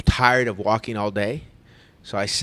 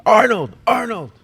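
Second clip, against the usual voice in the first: much higher, loud repeated call; insistent calling; 12 semitones higher, 7 dB louder than usual. Retold or imitated. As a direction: keep calling the name, getting pushy. Stranger keeps calling out name.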